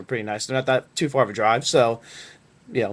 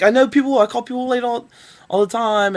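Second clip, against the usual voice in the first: much higher, mock-apologetic interviewer voice; mimicking the interviewer; 10 semitones higher, 4 dB louder than usual. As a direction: squeak her excuse in a put-on high voice.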